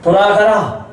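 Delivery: commanding. Acted. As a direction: send the petitioner away with authority. Jesus commands the pleading woman.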